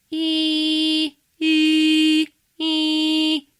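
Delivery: sung E vowels, high and ringing; vowel sung in contrasting throat shapes; open versus narrow E. Shown as a demonstration. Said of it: "that's the difference in sound".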